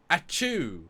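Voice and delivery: high, loud fake achoo; acted sneeze. Host plays Data sneezing after the pepper, mock high achoo. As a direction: burst out a pretend sneeze. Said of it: "and he goes".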